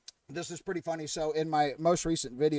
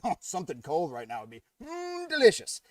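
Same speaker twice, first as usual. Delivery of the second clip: penguin craving ice cream; 2 semitones higher than usual. Acted. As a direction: savour the thought of something cold. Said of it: "he's thinking".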